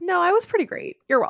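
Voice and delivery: put-on high, smug sassy teen. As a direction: toss it off with smug sass. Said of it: "Sassmaster Allison".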